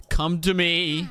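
hammed-up eerie summons; mock mysterious voice. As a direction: lure them in like a spirit. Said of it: "that strange voice".

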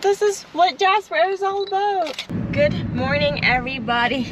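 high bright vlog hello; cheery host greeting. Real, not acted. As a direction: greet viewers with energy.

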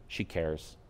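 soft low reassurance; calm inner voice. Retold or imitated. The new voice answering "She doesn't care", softly. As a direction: say it quietly and kindly. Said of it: "another voice happened".

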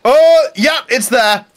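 startled. Real, not acted. Spots the spider, startled.

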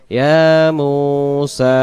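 slow chant, all three vowels of ইয়া মূসা held; ইয়া মূসা recited with evenly stretched syllables; one alif each. Shown as a demonstration. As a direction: lengthen yaa, muu and saa equally in ইয়া মূসা. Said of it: "তিন অক্ষরে এক আলিফ করে".

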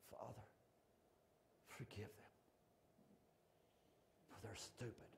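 very soft, low prayer; hushed plea. Acted. Jesus on the cross praying for his killers, hushed. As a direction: barely voice it, low, with long pauses. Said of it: "He said".

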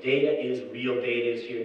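ordinary talk, nothing marked

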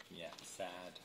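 mournful murmur; sad aside. A narrator's reading. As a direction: sigh the words to the listener. Aside, very soft and low.

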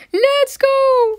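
loud, high whooping shout; excited cheer. Real, not acted. Rider cheering the coaster on, high excited shout. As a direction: yell it out as the ride takes off.

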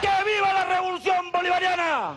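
shouted rally chant, pitched high; ranting dictator speech. Real, not acted. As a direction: bellow each slogan to the crowd. (arenga gritada a todo pulmón)

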